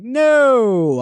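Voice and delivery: long, loud, high NO; retold refusal stretched out high and loud; emphatic mock refusal. Guest refusing the woman's stew, drawn-out mock-horrified cry.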